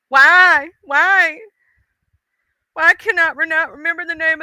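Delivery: frustrated loud why; exasperated cry. Real, not acted. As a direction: throw up your hands at your memory. Frustrated at forgetting the title.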